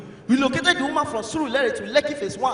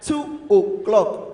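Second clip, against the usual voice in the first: very slow, lower-pitched reply; girl's drawn-out answer; 4 semitones lower, much slower than usual. Acted. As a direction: stretch each word right out. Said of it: "The girl go shout".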